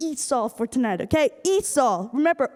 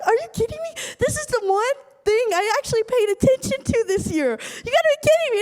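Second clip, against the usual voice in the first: distraught disbelief; 8 semitones higher than usual. Retold or imitated. Student stunned by D minus, high distraught disbelief. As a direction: cry out that this can't be real. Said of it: "I wanted to cry".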